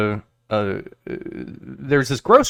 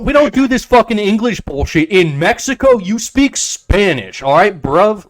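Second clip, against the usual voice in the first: angry local; 8 semitones higher, 3 dB louder than usual. Retold or imitated. Local scolding American expats, high loud mock rant. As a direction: yell it like an offended local. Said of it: "they're like".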